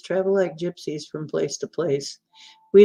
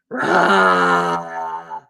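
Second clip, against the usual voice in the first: low, playful monster roar through a filter; mock monster roar; 12 semitones lower than usual. Showing friends the filter, a low mock monster roar. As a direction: roar like a cartoon beast to show off the filter.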